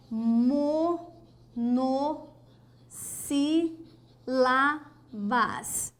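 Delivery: "monossílabas" drawn out very slowly, syllables apart. Shown as a demonstration. Teacher writing the next topic, "monossílabas" syllable by syllable. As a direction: leave long gaps between the syllables of "monossílabas". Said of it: "O que são as palavras monossílabas?".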